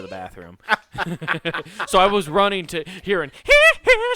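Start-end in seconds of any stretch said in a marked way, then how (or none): 3.4-4.1 very high, loud falsetto squeal; loud high-pitched "hee hee" squeal. Retold or imitated. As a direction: shriek it like a pop-star impersonator.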